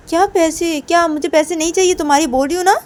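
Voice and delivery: loud, high indignant protest; offended outburst, loud throughout; angry refusal. Acted. Ruhi refuses money in acted quarrel.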